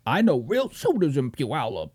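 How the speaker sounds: low, dopey mock tough-guy voice; put-on low voice making the boast sound stupid; mocking quote.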